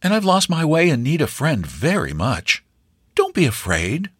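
lightly voiced gallant stranger. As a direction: reassure her with charm. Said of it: "he added gallantly".

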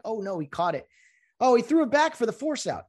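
high, rising panic of a baserunner; confused runner. Retold or imitated. Confused runner voiced in retelling.

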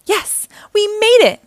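high, loud jubilant cry; triumphant cheer. A narrator's reading. Leo celebrates the escape, triumphant cheer. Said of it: "cheered Leo".

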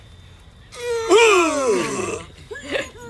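loud high wheezing gasp, wind knocked out. Retold or imitated. Both wrestlers after the landing, a loud winded gasp. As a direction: gasp as if all air just left you.

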